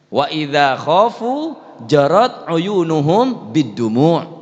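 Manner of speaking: slow, drawn-out Arabic recitation; preacher slowly chanting the verse to the congregation; quranic recitation. Acted.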